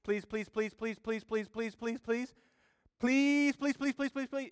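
high, wheedling, begging please; imitating a child nagging mom and dad, high and whiny; whining child. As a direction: beg like a nagging kid. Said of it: "We all know this if you have kids".

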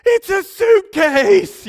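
put-on high, slow excited kid yell blurting the secret; child's shout. The boy blurts the gift to his uncle, a high excited shout. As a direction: shriek it out like a kid who can't hold it in. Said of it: "Judah walks over".

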